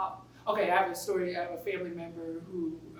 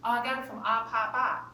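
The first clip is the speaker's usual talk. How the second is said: put-on Boston accent; 5 semitones higher, 5 dB louder than usual. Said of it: "she was saying".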